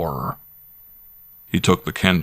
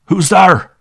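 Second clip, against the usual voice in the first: frightened shout, much higher and louder; wild call; 12 semitones higher, 10 dB louder than usual. Acted. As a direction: yell it out like he expects a robber. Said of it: "the squire wildly called".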